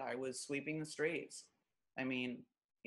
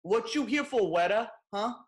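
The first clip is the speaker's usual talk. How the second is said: tough guy challenge; 4 semitones higher, 8 dB louder than usual. Retold or imitated. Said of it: "they're like".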